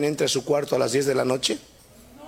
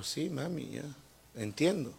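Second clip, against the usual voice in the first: put-on much softer, timid little boy; meek reply; 12 dB quieter than usual. Boy Marcelo answers his mother, very soft and meek. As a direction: answer mom barely aloud.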